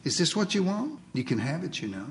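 softly voiced, not fully whispered; a quiet, gentle offer in someone's ear; lightly acted confiding murmur. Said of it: "leans over your shoulder and whispers".

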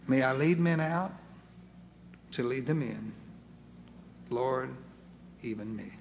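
sinking into soft, low submission; prayerful surrender. Acted. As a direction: pray it humbly, piece by piece.

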